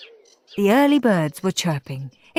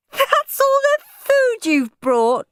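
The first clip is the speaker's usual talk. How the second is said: put-on much higher, slow sneering girl; 4 dB louder, slower than usual. A narrator's reading. Margaret sneering at Henry's suitcase food. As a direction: drag it out with scorn. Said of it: "she sneered".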